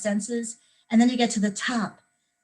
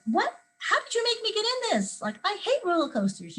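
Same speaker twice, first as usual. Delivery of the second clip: mock outrage; 11 semitones higher than usual. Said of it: "I can say to my friend".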